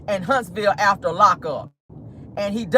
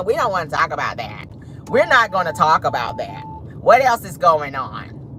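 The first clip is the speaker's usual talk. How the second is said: low-pitched mocking imitation; Carlos impression; 6 semitones lower than usual. Host mimicking Carlos avoiding questions, low deflecting voice. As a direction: drop the voice and dodge the subject. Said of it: "That's Carlos".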